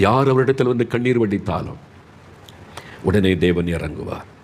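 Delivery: ordinary delivery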